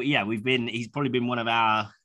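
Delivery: ordinary delivery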